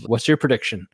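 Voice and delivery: normal talking voice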